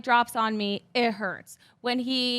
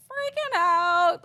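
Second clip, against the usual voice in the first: high, excited fangirl squeal; re-enacted giddy outburst; freaking out; 10 semitones higher, 4 dB louder, much slower than usual. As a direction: squeal the words in remembered excitement. Acting out her fan reaction.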